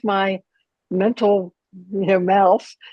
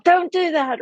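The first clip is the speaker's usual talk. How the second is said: put-on sudden high warning call, like a parent stopping a child; parent yelling; 12 semitones higher than usual. Parent yelling at a child near a hot stove, high and sharp. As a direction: cry out to stop the child. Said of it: "the parent needs to yell out".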